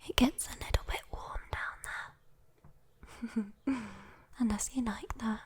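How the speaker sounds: usual speaking voice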